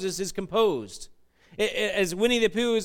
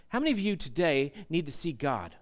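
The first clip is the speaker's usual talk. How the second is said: ordinary delivery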